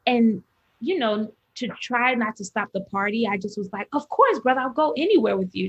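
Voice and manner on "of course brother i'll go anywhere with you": eager, agreeable reassurance; appeasing. Retold or imitated. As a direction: brightly humor him along. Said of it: "just, like, appeasing him for the moment".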